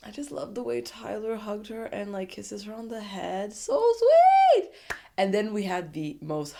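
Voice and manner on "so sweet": high, loud squeal of delight; loud gushing so sweet. Real, not acted. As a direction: squeal like you just saw something adorable. Reacting to Tyler's hug.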